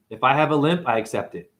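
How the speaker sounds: ordinary delivery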